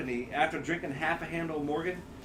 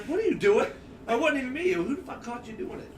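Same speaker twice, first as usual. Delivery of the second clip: indignant challenge; 7 semitones higher than usual. Retold or imitated. Retelling indignant challenge to friend.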